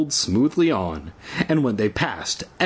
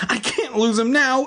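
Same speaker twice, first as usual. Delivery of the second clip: choked with panic; 12 semitones higher than usual. A narrator's reading. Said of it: "choked with panic".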